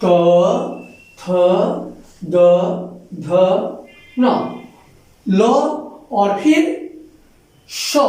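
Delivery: त, थ, द, ध, न, ल, स said one by one with the tongue on the teeth; teacher sounding out the dental letters; dental sounds demo. Shown as a demonstration. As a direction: sound out त थ द ध न ल स slowly for the class. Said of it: "इनमें दांतों की सहायता ली जा रही है".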